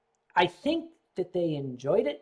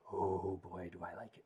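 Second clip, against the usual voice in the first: hushed relish; 12 semitones lower, 13 dB quieter than usual. Real, not acted. Gasping delight over sake.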